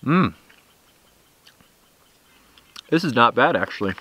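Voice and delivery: chewing between words; talking with food in mouth; mouth full while chewing. Real, not acted. Seen 0: Bite taken at -4; cheeks and lips move chewing through clip.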